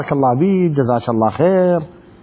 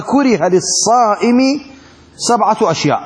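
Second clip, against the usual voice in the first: slow text reading; 4 semitones higher, slower than usual. Acted. Reads the text slowly.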